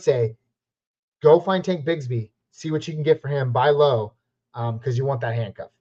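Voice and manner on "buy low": usual speaking voice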